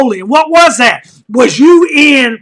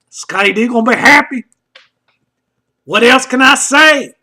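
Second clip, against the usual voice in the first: ordinary talk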